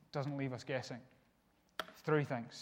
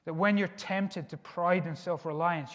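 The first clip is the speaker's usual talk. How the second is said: ordinary talk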